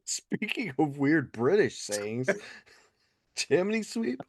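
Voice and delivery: laughing. Real, not acted. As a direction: giggle through the words. Laughing disbelief at saying.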